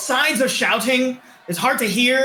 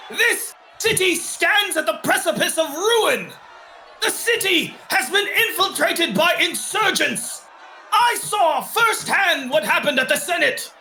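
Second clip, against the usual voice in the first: booming commander's address to a mob, higher and raised; Baronet shouting; 6 semitones higher than usual. Acted. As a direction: roar orders down at the crowd. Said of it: "you can hear the Baronet shouting over the mob".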